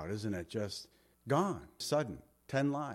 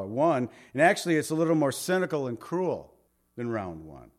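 ordinary delivery

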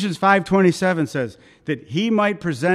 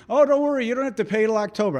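persistent salesman; 6 semitones higher, much faster than usual. Retold or imitated. Salesman telling customer payment can wait, high quick pitch. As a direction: brush the objection aside in a higher salesman voice.

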